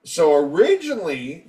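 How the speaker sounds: usual speaking voice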